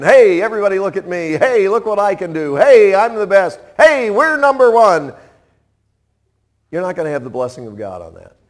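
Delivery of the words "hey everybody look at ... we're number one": loud, higher boasting calls; show-off bragging. Pretend. Preacher mimicking an attention seeker bragging loudly. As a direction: brag for everyone's attention. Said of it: "trying to get attention".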